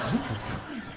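breathy whispered dismay; whispered stunned aside; whispered shock. Retold or imitated. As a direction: hush it in disbelief. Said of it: "I'm like".

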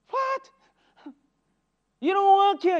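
high, loud disbelieving outburst; retold shopper's astonished cry. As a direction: act out the shock, with a laugh between. Shocked at shelf-stocking non-employee.

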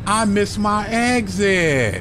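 high, slow, drawn-out whine on "exit"; stretched frustrated lament. Real, not acted. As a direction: moan it in disbelief. Missed exit while driving in game.

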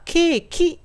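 ケーキ with a full final "ki" vowel; ケーキ as a Japanese loanword, vowel-final; Japanese katakana pronunciation. Shown as a demonstration. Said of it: "日本語ではケーキと言いますよね".

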